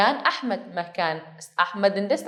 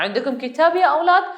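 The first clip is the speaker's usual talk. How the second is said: lightly voiced teacher, higher and brighter rising question; teacher asking children; 6 semitones higher, 3 dB louder than usual. Teacher asking the children if they have books, a lightly acted rising question. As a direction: call out to the class like a teacher checking for books. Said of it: "ഒരു teacher ചോദിക്കുകയാണ് കുട്ടികളുടെ അടുത്ത്".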